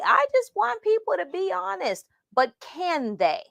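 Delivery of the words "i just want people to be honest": much higher, mock-earnest; what we all say. Mentors claiming they want honesty, mock-earnest and much higher. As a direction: parrot the stock line everyone says. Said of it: "now we all say".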